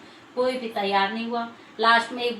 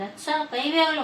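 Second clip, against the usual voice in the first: higher, incredulous echo; surprised question; 6 semitones higher than usual. Acted. Vinayak Baba echoing the old woman's offer, surprised. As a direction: ask it back in disbelief.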